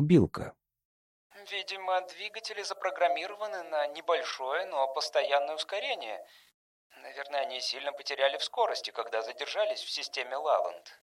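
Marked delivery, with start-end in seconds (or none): 1.5-11.0 very soft, slightly raised character voice; hushed, measured speculation; thoughtful woman crew member. Acted. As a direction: reason it out quietly. Voices Ronda in dialogue.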